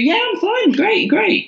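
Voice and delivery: fake cheerful. Tearful woman to taxi driver, forced high cheer. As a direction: pretend everything is fine. Faking being fine to driver.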